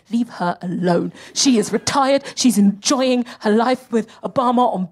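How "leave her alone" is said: low, slow, measured insistence; firm defence. Acted. Comic defending a former first lady, low slow firm order. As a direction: drop low and lay it down slowly. Calmer take of same demand.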